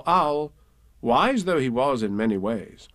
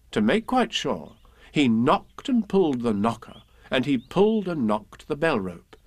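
usual speaking voice

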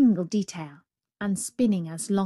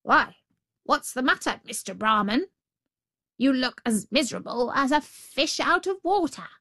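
lively higher jackal voice; jackal calling out; 6 semitones higher than usual. A narrator's reading. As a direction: hail him brightly in a raised pitch. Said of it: "called out".

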